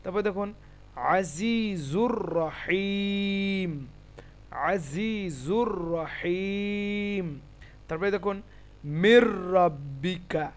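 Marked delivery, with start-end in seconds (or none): 1.2-7.2 very slow, long sustained vowels; Quranic recitation. Shown as a demonstration. Teacher reciting the next example for students, measured Quranic recitation. 9.0-10.5 slow "মির রব্বিকা" on one doubled r; merged r. Shown as a demonstration. Teacher showing the example word, merged r. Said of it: "এখানে উচ্চারণ কী হবে".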